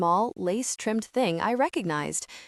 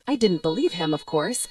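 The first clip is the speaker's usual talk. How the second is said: plain reading voice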